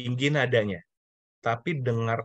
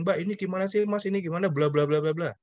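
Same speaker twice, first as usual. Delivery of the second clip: mock angry customer; 6 semitones higher than usual.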